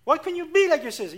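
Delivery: put-on scolding a child with a sibling comparison, loud and high; nagging parent. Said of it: "you say".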